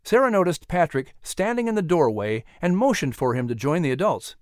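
usual speaking voice

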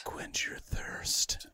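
very slow whispered slogan; eerie ad whisper. Pretend. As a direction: stretch the slogan out in a creepy whisper.

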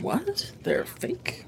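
breathless, hushed disbelief; barely voiced what?; stunned whisper. Real, not acted. Podcast host reacting to the fake iron, in hushed shock.

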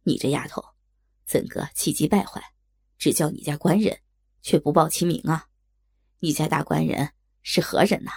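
deep-pitched helpful shopboy; kind waiter. A narrator's reading. Kind waiter asking a flustered maid whom she seeks, inquiring. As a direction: question her in a low waiter's voice. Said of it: "有好心的小二上前问道".

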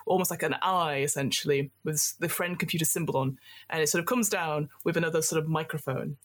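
usual speaking voice

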